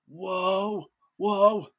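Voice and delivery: rising mock-amazed whoas; imitated wonder. Kid on his first plane speeding up the runway, imitated wonder. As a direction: act out mounting awe.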